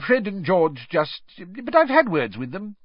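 high, halting excuse; evasive mumbling. A narrator's reading. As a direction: stumble through the excuse. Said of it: "mumbled Mister Weasley".

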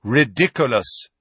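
firm, crisp, loud command of the spell; firm and clear. A narrator's reading. Lupin banishing the boggart, firm and clear. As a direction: say it with steady authority. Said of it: "he said, very firmly and clearly".

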